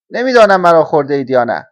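loud, cheerful outburst; happy little grasshopper piping up loudly. A narrator's reading. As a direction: break the silence brightly and loudly. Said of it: "صدای شاد ملخک سکوت را شکست".

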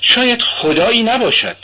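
high-pitched, spiteful retort. A narrator's reading. Raskolnikov answers Sonia's faith in God, spiteful and high. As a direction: throw it back with spite, pitched well up. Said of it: "با لحنی پر از کینه جواب داد".

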